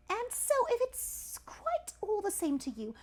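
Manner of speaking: high, slow, careful politeness; polite. A narrator's reading. Elephant's child begging not to be spanked, very polite. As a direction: plead politely with drawn-out high words. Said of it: "said the elephant's child most politely".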